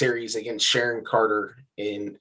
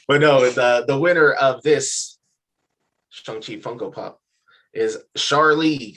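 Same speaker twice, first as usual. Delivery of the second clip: winner announcement; 3 semitones higher, 5 dB louder, slower than usual. Acted.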